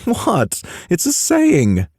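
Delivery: high defensive British voice; Pacey's British voice. Acted. Pacey defending his old saying. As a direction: protest as the British friend.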